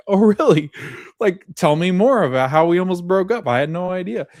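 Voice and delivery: high, loud, laughing mock surprise; teasing sarcasm. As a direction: tease her about the near breakup. Boyfriend teasing about near breakup.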